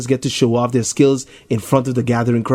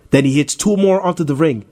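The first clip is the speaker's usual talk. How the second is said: ordinary delivery, nothing marked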